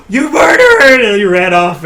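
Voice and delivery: high, shrieked accusation; hammed-up shriek of alarm; horrified scream. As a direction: scream it in mock horror after a gasp. Guard's horrified accusation, retold.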